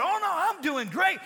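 high, loud, exultant retort; emphatic testimony. Retold or imitated. Grandmother telling friend God healed her, exultant. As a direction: proclaim it loudly, imitating her turnaround. Grandmother's corrected answer, loudly retold.

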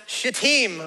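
"Shittim" with the second syllable stressed. Shown as a demonstration. Preacher carefully pronouncing an awkward town name, "Shittim" stressed on second syllable. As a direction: punch the end of "Shittim", not the start. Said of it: "put the emphasis on the second syllable".

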